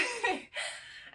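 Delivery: high burst of real laughter. Real, not acted. Laughing at herself while telling the fire story. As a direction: cracks up at her own coughing.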